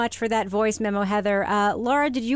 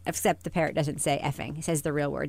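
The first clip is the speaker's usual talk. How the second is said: ordinary delivery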